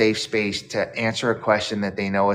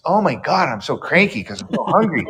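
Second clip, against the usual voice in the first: higher-pitched exasperated hungry grumble; cranky impatience; 6 semitones higher, 3 dB louder than usual. Retold or imitated. Waiting upstairs for food after a long day, cranky. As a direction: act out being fed up with waiting.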